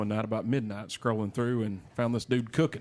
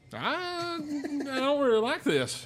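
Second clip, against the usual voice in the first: high, slow, uneasy voice; a scared little whine; nervous; much slower than usual. Retold or imitated. Uneasy about steep high seats.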